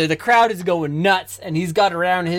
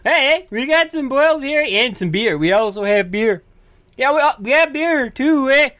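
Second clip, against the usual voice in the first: high, lilting offer; playful pitched-up bit with a tag "eh"; put-on vendor voice; 6 semitones higher than usual. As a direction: hawk food and beer in a silly voice. Plays along as herring seller.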